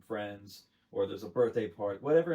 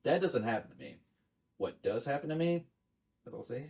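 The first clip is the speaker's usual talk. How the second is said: normal talking voice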